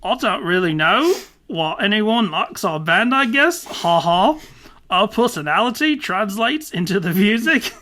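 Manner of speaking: hammy, loud Aussie read; mock Australian accent with stretched vowels; bad Australian accent. Podcaster reads band singer's answer, hammed-up Australian accent. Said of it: "And [name] says".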